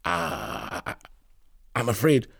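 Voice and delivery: faltering, halting start; stammering. A narrator's reading. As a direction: stumble over the words, embarrassed. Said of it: "Harris start to stammer".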